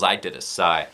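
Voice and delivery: usual speaking voice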